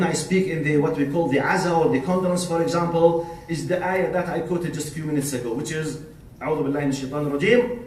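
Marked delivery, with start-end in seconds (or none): 6.4-7.8 a very fast recited formula; rapid formula. Real, not acted. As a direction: rattle off the opening formula. Formula said before quoting scripture.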